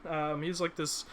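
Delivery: normal talking voice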